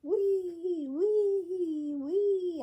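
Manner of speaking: a husband playing at riding his bike, squealing high like a child; childlike wee. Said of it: "he's going like this".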